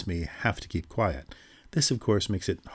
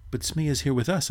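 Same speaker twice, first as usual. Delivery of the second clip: cried out; 7 semitones higher than usual. A narrator's reading. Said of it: "I cried".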